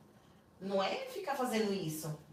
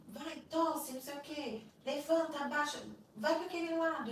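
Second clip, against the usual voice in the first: higher-pitched mock guard commands; retold bossy orders; 7 semitones higher than usual.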